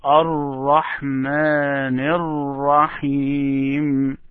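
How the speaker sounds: Ar-Rahman Ar-Rahim run together, long held vowels; no separation. Shown as a demonstration. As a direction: recite Ar-Rahman Ar-Rahim slowly as one unbroken phrase. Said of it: "So there is no separation".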